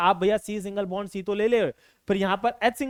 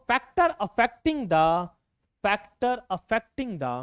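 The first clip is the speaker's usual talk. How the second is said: slow dictation; 3 semitones higher, 3 dB louder, much slower than usual. Shown as a demonstration. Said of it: "teacher dictating heading slowly".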